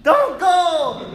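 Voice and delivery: high, loud, slow pleading snake; whiny snake voice. Pretend. Preacher voicing a snake clinging to old skin, high pleading whine. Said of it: "like a snake during molting season".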